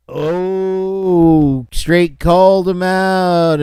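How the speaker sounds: high, drawn-out "oh" of a goading crowd; mock onlooker whoop. Host imitates onlookers at a callout, mock whooping. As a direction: imitate bystanders stirring up a fight.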